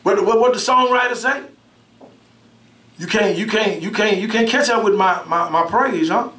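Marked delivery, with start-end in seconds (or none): none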